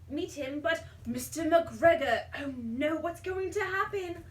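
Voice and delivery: plain reading voice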